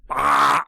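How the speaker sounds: short, sudden mock "ah"; mock yell. Comic describing a crude video to co-host, mock yell. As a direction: blurt out a comic little yell. Imitating the view from inside.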